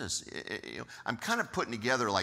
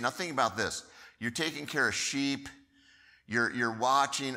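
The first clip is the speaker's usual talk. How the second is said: ordinary delivery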